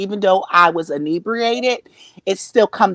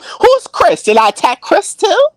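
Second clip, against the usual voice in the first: high loud disbelief; shocked high shout; 10 semitones higher, 7 dB louder than usual. Real, not acted. Shocked at own clip.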